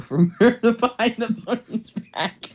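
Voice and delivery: reading through giggles, pitch jumping up; can't hold back a laugh mid-line; laughing while reading. Real, not acted. Reader cracks up mid-sentence.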